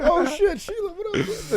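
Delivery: high excited hey; mock greeting. Greeting an old Sheila in a high excited voice. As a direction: greet her like an old friend, squeaky.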